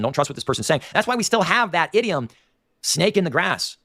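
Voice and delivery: ordinary talk, nothing marked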